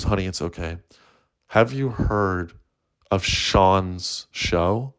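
calm them down slowly, acted; mock-gentle therapist, drawing out the question; soothing therapist. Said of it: "the therapist goes".

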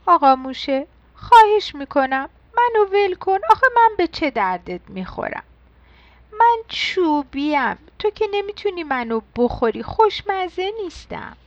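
high-pitched pencil begging for its life; pleading. Acted. Pencil begs the mouse not to eat it, pleading. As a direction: beg in a small, high storybook voice. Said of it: "مداد التماس کنان گفت".

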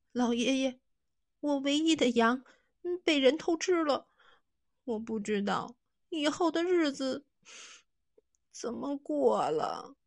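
put-on weep it out to the old man; boy crying over his lost sheep; tearful plea. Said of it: "抹了抹眼泪".

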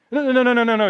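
high, loud repeated no's; emphatic protest. Acted. Buyer pleading with the woman not to misunderstand, loud and urgent. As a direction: urgently stop her, insisting. Man protesting her reaction.

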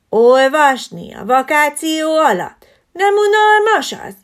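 can't believe anyone reads on holiday; child amazed the teacher will read all summer; astonished child. Acted.

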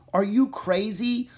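high, loud, slow incredulous question; alarmed disbelief. Acted. Jack follows Annie toward the gate, incredulous. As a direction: stretch it out in high-pitched disbelief. Boy objects to risky plan.

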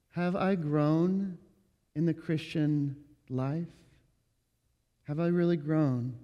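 low, quiet, subdued admission; hushed, downcast self-doubt; discouraged confession. Acted. Voicing a discouraged member confessing to pastor.

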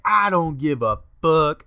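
loud, drawn-out Irish accent imitation; high, slow, defiant Irish brogue; Conor McGregor. Buddy answers friends who warned him off the wires, defiant Irish accent. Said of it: "he did it in that accent".

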